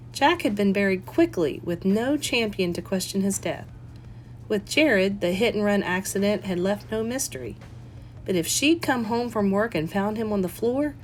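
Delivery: ordinary delivery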